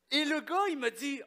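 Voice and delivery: normal talking voice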